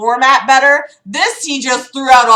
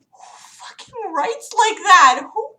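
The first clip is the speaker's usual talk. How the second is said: much higher, slow disbelieving cry; incredulous outburst; 10 semitones higher than usual. Real, not acted. Reacting to the book's sentence, slow and incredulous. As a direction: ask it in real disbelief. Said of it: "exasperated outburst".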